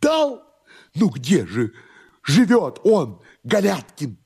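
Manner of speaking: high, agitated questioning; anxious master. Acted. Master asks servant for the address, high anxious questioning. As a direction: press for an answer in a high voice.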